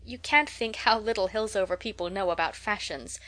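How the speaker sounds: ordinary delivery, nothing marked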